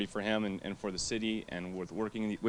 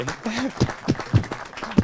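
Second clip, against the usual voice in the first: breathy laugh; 12 semitones higher than usual. Real, not acted. Thanks mom, overcome.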